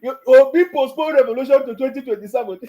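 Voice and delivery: loud mocking jeer at the headline; mocking repeat. Real, not acted.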